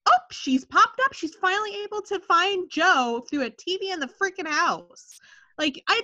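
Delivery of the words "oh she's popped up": high mock surprise; sarcastic, high and surprised. Mocking Piper's reappearance.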